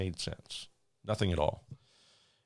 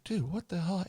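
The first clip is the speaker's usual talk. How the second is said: much higher disbelieving cry; incredulous; 12 semitones higher than usual. Retold or imitated. Flight attendant baffled by the pilot, high and incredulous.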